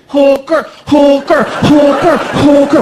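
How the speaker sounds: high, repeated chanting yell; child's tantrum. Retold or imitated. Son throws a fit in the kitchen, chanting tantrum. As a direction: imitate a kid throwing a fit. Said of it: "He throws a fit".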